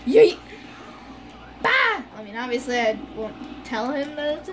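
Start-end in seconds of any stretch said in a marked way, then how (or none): 0.1-0.4 loud playful yell; shout. Real, not acted. Gaming outburst. 1.6-2.0 excited yelp. Real, not acted. Gaming outburst.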